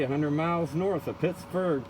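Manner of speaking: ordinary talk, nothing marked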